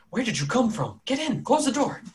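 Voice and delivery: high, urgent demand; urgent challenge. Acted. Armed townsfolk to arriving strangers, urgent and high. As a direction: hurry them in, pitched high.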